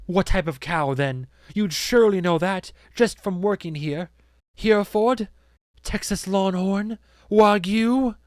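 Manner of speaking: high, loud, scornful quizzing; mounting prosecutorial demand; imperious interrogator. Acted. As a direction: throw each option at the witness like a challenge. Accuser fires questions at worker.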